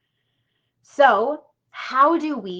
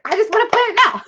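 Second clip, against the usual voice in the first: high, loud, impatient imitation of an eager student; eager mock whine; 11 semitones higher, 7 dB louder, faster than usual. Coach mimicking an eager student, high and impatient. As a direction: mimic her itching to jump ahead, pitched way up. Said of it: "She's like".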